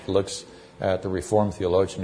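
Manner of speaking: ordinary talk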